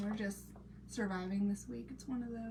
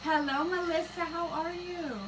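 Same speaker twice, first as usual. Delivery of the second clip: higher, cheery hello to a viewer; cheerful hello to a commenter; bright greeting; 8 semitones higher, 4 dB louder than usual. Real, not acted. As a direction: sing out the welcome. Greeting viewer in live chat.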